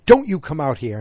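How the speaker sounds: a loud, sharp retold yell. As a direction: bark it out as the boss would. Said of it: "she just yells at him".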